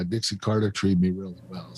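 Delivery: ordinary talk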